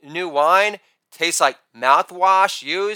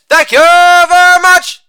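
high, loud, sustained catchphrase; showman's ringing thank-you; showy sign-off; 11 semitones higher, 8 dB louder than usual. Acted. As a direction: belt it out on one held high note.

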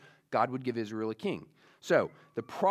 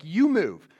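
insistent command; 4 semitones higher, 8 dB louder than usual. Retold or imitated. Captain's demand, paraphrased by preacher.

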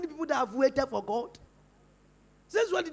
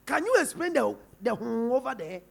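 ordinary talk

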